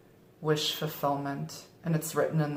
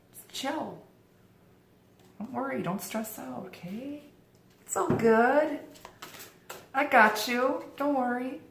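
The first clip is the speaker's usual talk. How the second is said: playful reassurance; 8 semitones higher than usual. Acted. Reader telling viewers not to stress, playful reassurance. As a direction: soothe them in a small, lifted voice.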